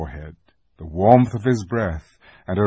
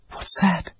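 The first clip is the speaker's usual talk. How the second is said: put-on high, soft startled girl; a frightened little girl's question; 10 semitones higher, 4 dB quieter than usual. A narrator's reading. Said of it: "said Lucy".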